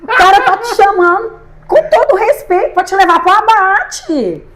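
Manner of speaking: high, loud, slow indignant laughter; laughing outrage. Real, not acted. Telling the host about the invitation, laughing in outrage. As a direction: laugh it out in disbelief, voice riding high.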